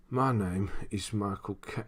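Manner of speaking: slow, put-on Cockney actor voice; Michael Caine. Streamer retrying a failed actor impression, slow and put-on. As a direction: try the impression slowly, cut off unfinished. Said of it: "I can't do it at all".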